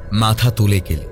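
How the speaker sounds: ordinary delivery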